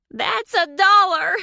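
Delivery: shrill, high, loud, slowed shout. A narrator's reading. Deborah fining the swearer with her jar, shouting. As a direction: scold at the top of her lungs. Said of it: "Deborah shouted".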